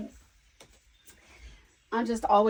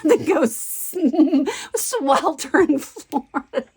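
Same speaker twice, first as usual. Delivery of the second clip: laughing; 5 semitones higher, 3 dB louder, slower than usual. Real, not acted. Laughing at own dread of heat.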